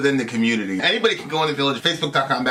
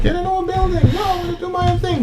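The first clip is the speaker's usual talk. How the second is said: high squeaky imitation; high mock voice; 5 dB louder than usual. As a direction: squeak it like a frantic gamer. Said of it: "I'm like".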